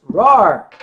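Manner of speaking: loud drawn-out roar; dragon roar. Pretend. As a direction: bellow it like a dragon. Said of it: "Roar dragon says".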